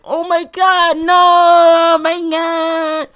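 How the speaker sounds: high, loud mock scream, words stretched out; terrified screaming girls. Schoolboy imitating girls who saw a teacher fall, terrified mock scream. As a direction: imitate girls shrieking in horror, hammed-up. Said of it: "they were so terrified".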